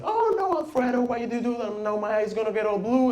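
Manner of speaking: very high mock wail of distress; wailing grandma. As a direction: imitate a grandmother lamenting in falsetto. Acts out grandma after being hit.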